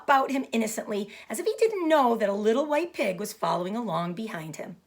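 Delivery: plain reading voice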